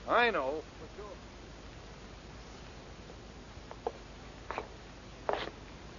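fading to a soft whisper; fading echo. Acted. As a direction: let it fade away to nothing. Line fades away into silence.

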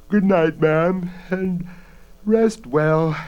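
drowsy, drawn-out goodnight; sleepy. Acted. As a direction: say it half asleep. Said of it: "Bella said sleepily".

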